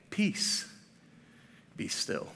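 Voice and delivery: soft, hushed "Peace, be still"; gentle peace be still. Shown as a demonstration. Pastor contrasting the gentle translation, hushed. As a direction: breathe it out gently like a soft command. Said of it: "other translations would say".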